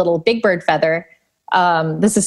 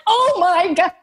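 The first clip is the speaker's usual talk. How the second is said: overwhelmed; 10 semitones higher than usual. Retold or imitated. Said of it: "I'm like".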